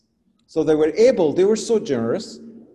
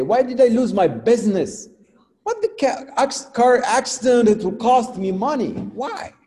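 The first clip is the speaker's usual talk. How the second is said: complaining questions; 7 semitones higher, 4 dB louder than usual. Retold or imitated. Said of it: "and you keep asking".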